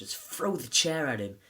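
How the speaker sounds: normal talking voice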